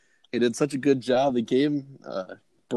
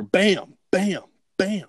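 high-pitched, spaced-out bams; imitated distant gunshots; 8 semitones higher, much slower than usual. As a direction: sound out three far-away rifle shots. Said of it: "a ways in the distance, I hear".

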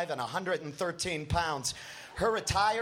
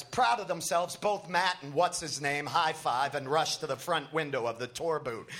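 ordinary delivery, nothing marked

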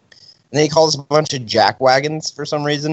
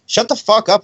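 high-pitched mock snap; retold yelling back; 10 semitones higher than usual. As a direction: imitate an indignant teen talking back. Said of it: "yelling at him back".

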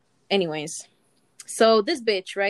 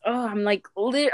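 normal talking voice

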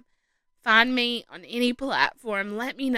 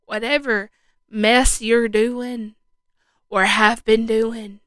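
fervent, louder preaching; 6 dB louder than usual. Acted. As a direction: preach it with conviction. Preaching at full force.

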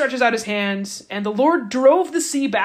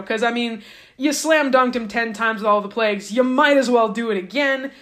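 ordinary delivery

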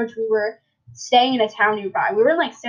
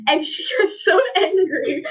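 laughing; 7 semitones higher than usual. Real, not acted. Laughing while telling story.

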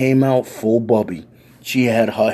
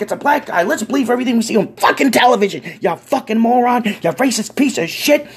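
high, loud, furious granny rant; old lady tirade; 12 semitones higher, 3 dB louder than usual. Retold or imitated. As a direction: let the old lady rip into him. Old lady's tirade at cops.

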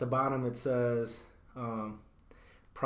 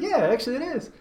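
retold proud yes; 11 semitones higher, 3 dB louder than usual. Proud reply to compliment retold.

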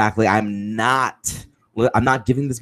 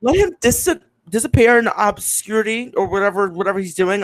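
high-pitched scoff; 8 semitones higher than usual. Retold or imitated. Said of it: "And I'm like".

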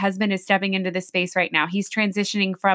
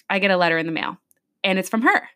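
ordinary talk

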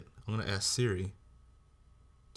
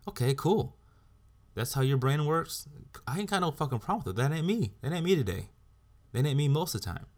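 raised, fed-up insistence; heated rant; 7 semitones higher than usual. Real, not acted. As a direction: hammer it home, irritated.

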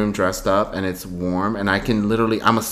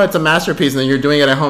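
ordinary talk

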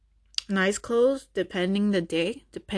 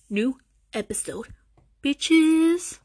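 sassy; 5 semitones higher, much slower than usual. Real, not acted. Sassy hook before the episode.

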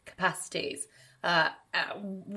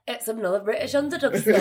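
quick, louder mock Northern English accent; 5 semitones higher, 5 dB louder, faster than usual. Shown as a demonstration. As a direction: put on a brisk Northern accent, pitched up. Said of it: "you should be like".

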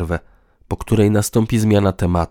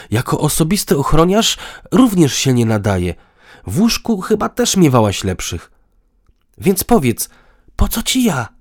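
building higher and louder; heated challenge; 8 semitones higher, 4 dB louder than usual. A narrator's reading. Man demands why partner needs him, increasingly heated. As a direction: press the point, growing more agitated. Acted quarrel, man listing grievances.

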